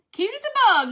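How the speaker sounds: reading a viewer's comment with delight, high and loud; excited. Retold or imitated. Reading Norma's chat comment aloud, high excited squeal. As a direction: lift it up, gleeful and bright. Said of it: "Norma said".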